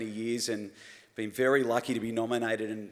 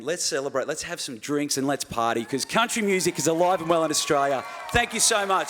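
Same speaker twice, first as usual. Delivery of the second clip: building to a loud, higher cheer; excited rallying shout; 4 semitones higher, 5 dB louder than usual. Real, not acted. Winner calling the audience to celebrate, building to a loud shout. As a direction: whip the crowd up, ending in a shout.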